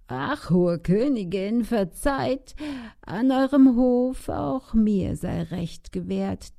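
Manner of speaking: put-on old woman crying out for justice. A narrator's reading. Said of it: "empor und schreit".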